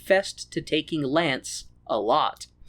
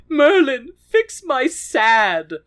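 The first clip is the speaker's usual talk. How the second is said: high, loud call, then slow; whiny plea; 9 semitones higher, 5 dB louder, much slower than usual. Retold or imitated. Werther begging Merlin for help, high slow whine. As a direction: wail at Merlin to fix it.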